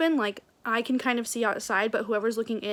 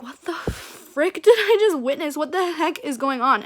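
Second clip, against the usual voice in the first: louder, higher outraged disbelief; retold "what on earth" shock, drawn out at the start; mock disbelief; 5 semitones higher, 6 dB louder than usual. Retelling her stunned reaction.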